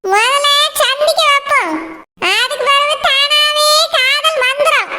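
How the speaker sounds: a grandmother muttering her matchmaking plan; scheming old grandmother. Acted.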